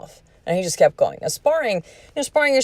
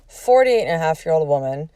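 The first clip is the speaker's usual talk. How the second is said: ordinary delivery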